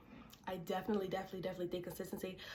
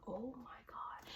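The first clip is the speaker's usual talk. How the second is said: whispering; 3 semitones higher, 10 dB quieter, much slower than usual. Retold or imitated. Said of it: "I'm like".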